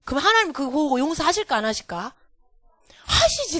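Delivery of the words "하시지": sharply high-pitched eager "하시지"; emphatic yes. Real, not acted. Teacher answering the children with eager certainty. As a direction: jump up high to insist he surely does.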